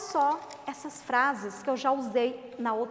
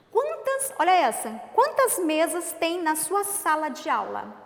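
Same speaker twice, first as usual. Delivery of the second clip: high, louder questioning tune; teacher modelling a question to kids; asking question; 3 semitones higher, 6 dB louder than usual. Shown as a demonstration. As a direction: ask it clearly with a rising quiz tone. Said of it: "Eu fiz o que pra você? Uma pergunta".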